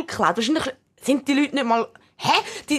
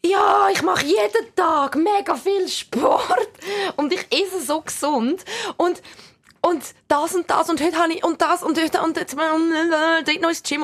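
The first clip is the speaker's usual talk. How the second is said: high, slow, whiny bragging; mock a fitness bragger; show-off voice; 4 semitones higher, slower than usual. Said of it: "die sagen".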